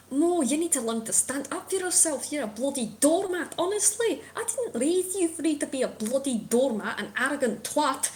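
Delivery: high, scornful British scold; father's accent back. Acted. Spectral father scolding Mo for being a doormat, scornful. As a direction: tear into them like a fed-up dad. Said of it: "my accent's gone".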